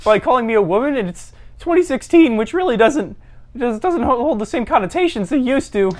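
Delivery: high, halting mock protest; indignant meek caller. Bullied caller lecturing Chad on women, high indignant protest.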